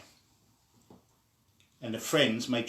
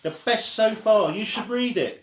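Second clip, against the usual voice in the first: higher and louder, still calling out; still calling back; 9 semitones higher, 6 dB louder than usual. A narrator's reading. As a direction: keep shouting the recommendation across. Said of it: "called back the friend".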